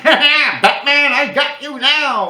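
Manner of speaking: high, loud, slow villain gloat. Retold or imitated. Mocking [name]'s face as a loud slow Joker taunt. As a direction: cackle it out like a cartoon villain closing in. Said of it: "like the Joker".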